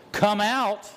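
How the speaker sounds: loud command. Retold or imitated. Jesus calling every tomb open, loud high command. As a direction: bark it out like a general summons. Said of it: "If he'd have just said".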